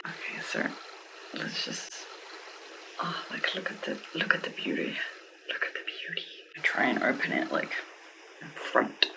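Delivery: hushed whisper over the unboxing; soft unvoiced whisper. Real, not acted. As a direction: breathe it out in an awed whisper.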